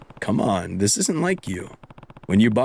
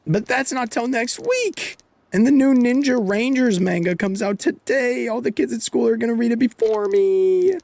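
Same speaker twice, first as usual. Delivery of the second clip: put-on much higher, louder pleading kid; whiny kid; 12 semitones higher, 3 dB louder than usual. Taichi begging Ayako for his money, high and whiny. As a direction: whine like a kid denied his manga.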